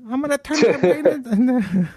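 high, slow hammed-up voice with a laugh; laughing falsetto mockery. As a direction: play it as an over-the-top melodrama. Acting out the Selena ending.

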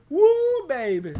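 high, loud woo; playful whoop; excited whoop. Real, not acted. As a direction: cheer it out.

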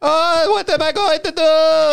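high, slow fake Borat accent; Borat-style accent, high and drawn out; Borat impression. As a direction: do a hammed-up Borat. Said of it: "You kinda went Borat on us".

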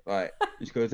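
ordinary talk

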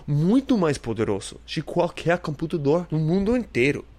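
high, bright fact-reading voice; announcer voice. Acted. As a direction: read it like a trivia announcer. Reads facts in another voice.

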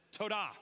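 Todah said as TOH-dah, stress first; Hebrew word Todah. Shown as a demonstration. Teaching the congregation: Todah as TOH-dah. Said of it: "Everybody say Todah".

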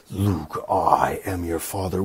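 lightly voiced low Vader; a hint of the dark lord, low and slow; Darth Vader. Vader revealing himself to Luke, lightly voiced low. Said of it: "he finds out at the end that".

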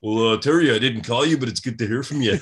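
loud, amused mock wrestler reply; Bret Hart impression. Wrestler baffled by colleague's returned call, loud comic impression. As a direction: imitate a puzzled but polite Canadian. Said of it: "I'm sure Bret Hart somehow".

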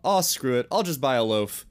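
usual speaking voice

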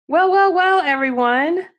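high, loud playful welcome; excited welcome. Real, not acted. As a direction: open the show hyped up. Host opens show.

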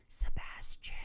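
breathy dying whisper; whispered name. Acted. Reading out the dying woman's last word, whispered. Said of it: "died after whispering the name".

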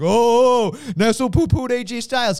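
loud, mock-outraged quote of the critics; mocking co-hosts. Host imitates co-hosts who poo-pooed him, mocking. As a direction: exaggerate their complaint, opening on a loud "Oh". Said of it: "you guys were like".

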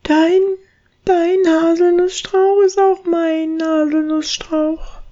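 high, tender mouse thought; squeaky mouse voice. Acted. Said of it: "dachte die Haselmausbraut". (hohes, zärtliches Piepsen)

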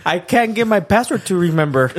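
mocking imitation. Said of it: "He said".

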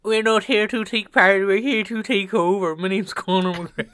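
high, loud Irish fighter impression; Conor McGregor. Showing friend how the fighter talks, loud Irish impression. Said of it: "That's how he talks".

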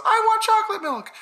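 very high squeaky belly voice; talking stomach. Retold or imitated. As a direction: play the tummy in a tiny high voice. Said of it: "we made voices for our tummies".

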